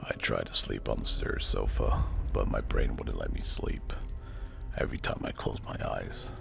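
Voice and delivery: usual speaking voice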